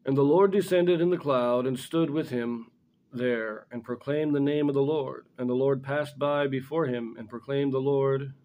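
normal talking voice

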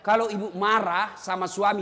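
ordinary delivery

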